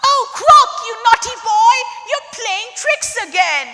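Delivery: tut-tut a naughty pet; scolding lady. Acted. Lady bursts in and scolds her crocodile, high and fond. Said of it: "She cried out".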